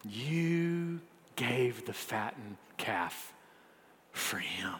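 drawn-out slow line, "for him" dropped to a whisper; hushed and slow, landing on a low, whispered "for him"; stress on "for him". Shown as a demonstration. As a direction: set "for him" apart, barely voiced. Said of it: "just those last two words".

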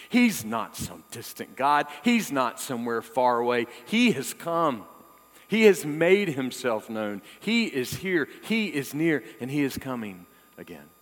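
building fervent preaching; preaching climax. Acted. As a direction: declare it with rising conviction.

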